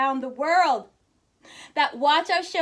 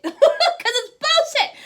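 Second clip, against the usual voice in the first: much higher, laughing, slowed; 12 semitones higher, 8 dB louder, slower than usual. Real, not acted. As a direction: squeal it out through laughter. Laughing at her own words.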